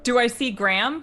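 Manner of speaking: normal talking voice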